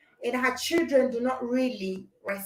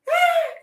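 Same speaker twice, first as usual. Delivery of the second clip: sharp high surprised gasp; 4 dB louder than usual. Real, not acted. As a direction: gasp in mock shock. Mock shock at comment.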